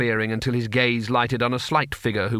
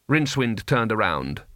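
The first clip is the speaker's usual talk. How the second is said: ordinary delivery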